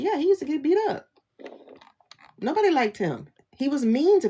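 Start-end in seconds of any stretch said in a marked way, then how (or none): 0.0-1.1 quoted classmate. Retold or imitated. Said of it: "they were like".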